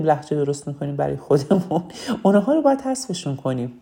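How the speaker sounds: usual speaking voice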